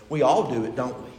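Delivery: normal talking voice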